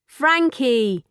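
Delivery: lightly voiced robot, evenly repeating the name. Robot copying its new friend's name, lightly mechanical. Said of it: "the robot repeated".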